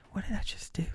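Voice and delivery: whispered, dazed. Pretend. Someone alone after four lost hours, in a dazed whisper. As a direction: whisper it as if you just woke up.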